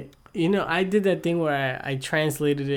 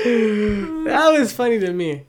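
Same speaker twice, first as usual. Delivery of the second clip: laughing; 6 semitones higher, slower than usual. Real, not acted. Still laughing.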